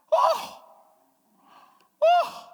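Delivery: very high, loud cries of delight; ecstatic cries. Retold or imitated. Parent overwhelmed by a newborn, high squealing delight.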